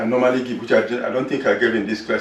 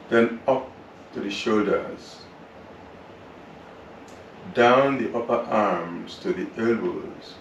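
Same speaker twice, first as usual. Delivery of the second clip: calm, unhurried relaxation voice; guided meditation; 6 dB quieter than usual. Acted. Yoga teacher guiding students through relaxation, calm meditation voice. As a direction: guide the class gently through the body.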